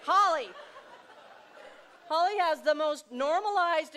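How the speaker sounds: loud, high punchline name; spring the name on the crowd; gleeful reveal. Real, not acted. Naming daughter as punchline.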